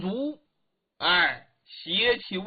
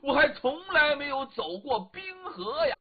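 delighted; 6 semitones higher than usual. Acted. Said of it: "朗声大笑啊".

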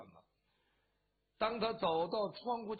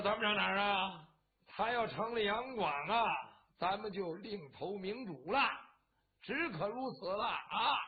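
much louder, booming loudmouth; loud tipsy declaration. Acted. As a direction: hold forth drunkenly, voice up. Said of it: "纸糊的马大嗓门儿". (大嗓门儿借酒劲高声说)

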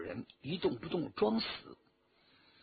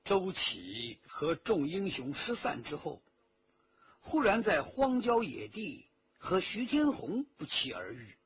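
ordinary delivery

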